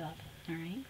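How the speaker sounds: hushed and tender; soft whisper. Real, not acted. Sending good vibes to Debbie, softly whispered. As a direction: whisper it to a friend.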